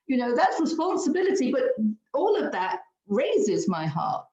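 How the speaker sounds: normal talking voice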